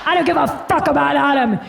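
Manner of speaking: a lightly voiced boozy Luann; imitating Luann. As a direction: bark it like a tipsy Luann. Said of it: "Luann's chiming in of like".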